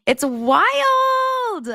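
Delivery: high squeal of disbelief. Real, not acted. Streamer reacting to wild texts, squeaking in disbelief. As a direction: squeak it out in astonishment.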